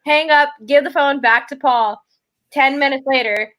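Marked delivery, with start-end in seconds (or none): none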